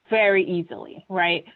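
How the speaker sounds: normal talking voice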